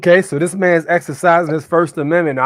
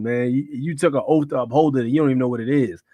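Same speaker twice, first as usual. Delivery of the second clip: usual speaking voice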